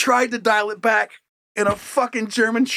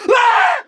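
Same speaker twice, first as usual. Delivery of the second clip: shrill, loud yelp of fright; high startled scream; 8 dB louder than usual. Retold or imitated. Host voicing the chihuahua's panic, high scream. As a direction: yelp like a terrified little dog.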